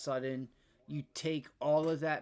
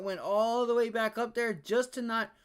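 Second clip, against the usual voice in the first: incredulous loud rant; 8 semitones higher, 6 dB louder than usual. Real, not acted. Ranting at market reversal.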